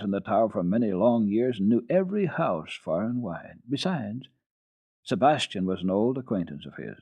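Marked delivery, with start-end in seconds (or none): none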